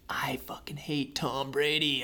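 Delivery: mock grumbled whisper of a grudge. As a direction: whisper it like a sore loser. Retelling his own bet grudge.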